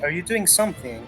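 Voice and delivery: usual speaking voice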